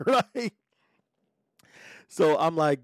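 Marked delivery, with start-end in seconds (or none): 0.0-0.5 laughing. Real, not acted. Girl bursts out laughing.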